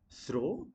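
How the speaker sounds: through said as "throw"; mispronounced through. Shown as a demonstration. Teacher quizzing learners, through wrongly said "throw". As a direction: round "oh" vowel on throw. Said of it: "not throw".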